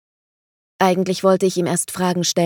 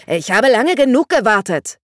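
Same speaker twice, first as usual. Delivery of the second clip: sharp, louder rebuke, pitched higher; snapping angrily; 5 semitones higher, 6 dB louder than usual. A narrator's reading. Vangelis snaps at the father, sharp and raised. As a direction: bite the words off at an old rival. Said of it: "fuhr Vangelis ihn an".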